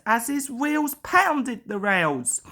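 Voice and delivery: ordinary delivery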